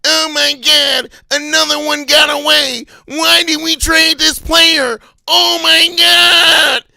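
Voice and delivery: loud, high, drawn-out mock wail; hammed-up outraged whine; mock whining fans. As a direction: mimic complainers wailing at full volume. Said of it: "they whine, bitch, and complain".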